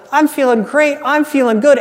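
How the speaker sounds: acted-out cheerful confidence; upbeat mock self-talk. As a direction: lift the pitch, play the happy crowd-goer.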